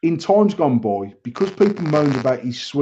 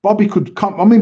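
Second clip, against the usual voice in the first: ordinary delivery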